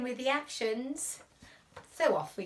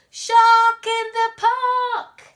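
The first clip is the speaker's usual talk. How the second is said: high, very loud, drawn-out call; shout till fit to bust; 11 semitones higher, 15 dB louder, slower than usual. Acted. Boy spotting the shark, shouting fit to bust. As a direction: yell it as loud as he can. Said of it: "He shouts".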